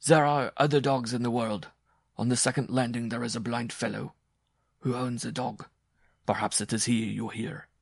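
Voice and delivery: loud, forceful start easing slightly; angry. A narrator's reading. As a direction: argue back with some irritation. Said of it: "the bareback rider said angrily".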